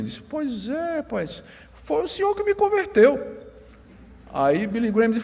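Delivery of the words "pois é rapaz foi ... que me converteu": put-on higher, lightly slurred drunk; tipsy passenger, lightly voiced. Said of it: "eu não sei imitar o bêbado".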